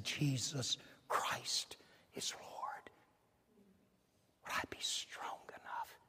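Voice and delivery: slow whispered confession of faith; hushed whisper. Pretend. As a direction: breathe it out as if before death. Imagined confession under threat.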